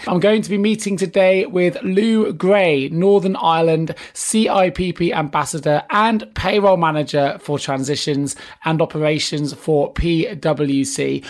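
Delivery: ordinary talk, nothing marked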